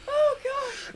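high, helpless laughing gasp. Real, not acted. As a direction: wheeze it out through laughter.

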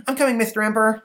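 put-on high, loud, eager boy. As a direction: call out like a helpful kid. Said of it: "says Stanley".

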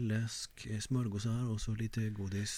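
quiet, hesitant train-host list; soft intercom listing with pauses. Acted. Host imitates train staff listing snacks, quiet halting voice. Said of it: "Tågvärdar är lite".

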